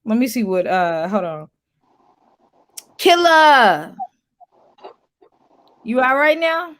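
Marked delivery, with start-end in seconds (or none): 3.0-6.8 calling out. Real, not acted. Calling to guest on stream.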